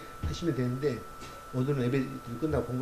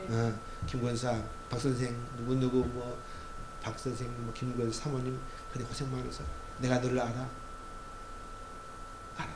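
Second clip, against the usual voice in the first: quiet tender God voice; 3 semitones lower, 9 dB quieter than usual. Acted. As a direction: murmur each name like a blessing. God addresses believers softly.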